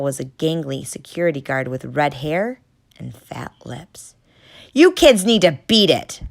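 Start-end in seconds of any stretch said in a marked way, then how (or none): 4.7-6.2 loud bossy yell. Acted. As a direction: chase the kids off. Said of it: "the guard yelled".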